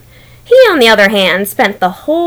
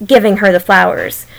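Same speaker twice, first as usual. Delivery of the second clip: usual speaking voice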